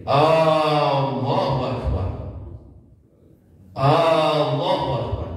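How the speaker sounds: Allahu with a drawn-out glottal A, slow; wrong hamzah on Allahu. Shown as a demonstration. Teacher demonstrates the muezzin's mistake with a long hamzah. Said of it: "muadzin banyak yang terjadi".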